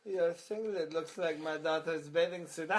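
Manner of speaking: slow, laughing squeaky-creature take on Shinsuke; mock Ewok Shinsuke voice, slowed down and laughing. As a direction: make him sound like a little Ewok through giggles. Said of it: "made Shinsuke sound like a fucking Ewok".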